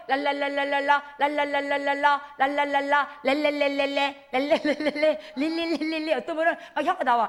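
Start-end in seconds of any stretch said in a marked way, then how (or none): 0.1-5.2 랄랄라 and 렐렐레 flicked fast with the tongue alone; just flap the tongue, lips still; tongue-only babble. Shown as a demonstration. Showing what tongues sound like when only the tongue moves. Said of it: "혀만 움직이면 뭐가 돼요".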